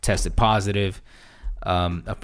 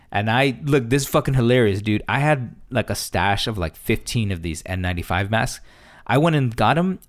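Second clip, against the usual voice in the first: usual speaking voice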